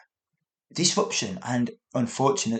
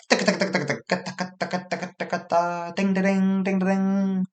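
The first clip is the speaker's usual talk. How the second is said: chanted taka taka of train wheels and a ding da ding crossing bell; playful train noises; imitating train sounds; 6 semitones higher, faster than usual. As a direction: mimic a passing train rhythmically. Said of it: "It was like".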